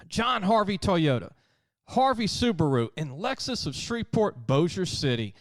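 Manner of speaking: bright, punchy ad read, hitting each dealership name. Acted. Host reads a car dealer ad to listeners, upbeat pitch. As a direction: sell the dealerships like a radio spot.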